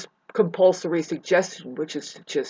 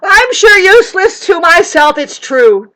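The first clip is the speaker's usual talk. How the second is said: much higher, much louder sarcastic cry; mock surrender; 11 semitones higher, 11 dB louder than usual. Mocking how narcissists want her to answer, in a high loud sarcastic cry. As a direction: fling the fake confession out sarcastically. Mock self-deprecating reply.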